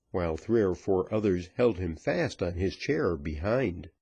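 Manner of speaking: plain reading voice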